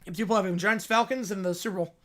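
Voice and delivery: ordinary talk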